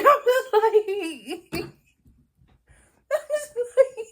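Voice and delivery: high, squealing helpless laugh through the words; words dissolve into real, loud laughter; can barely get it out for laughing; laughing too hard to talk. Real, not acted. Breaks up laughing mid-story.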